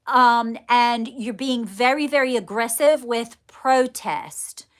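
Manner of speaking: ordinary talk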